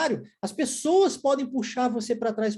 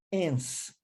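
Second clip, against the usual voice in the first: "ence" said alone, lower and softer; syllable before pause; 5 semitones lower, 6 dB quieter than usual. Shown as a demonstration. Teacher splits influence for learners, "ence" set apart by pauses. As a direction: isolate "ence" as the last syllable. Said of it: "outra pausa".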